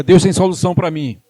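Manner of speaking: loud line for the congregation to repeat; rallying declaration. Shown as a demonstration. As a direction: call it out with conviction.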